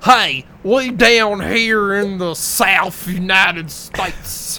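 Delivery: slow, high, loud hammed-up drawl; bad Southern accent. Mocking Hurricane Heist actors with a hammed-up Southern drawl. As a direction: overdo a fake movie Southerner. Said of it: "Everyone else was".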